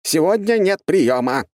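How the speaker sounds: high, drawn-out yell; yelled refusal. A narrator's reading. Doctor inside the flat turns visitors away, yelling. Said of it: "раздался вопль из квартиры".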